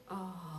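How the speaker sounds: low soft pitying oh; sympathetic groan. Shown as a demonstration. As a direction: let out a soft sorry groan. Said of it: "Let's all go".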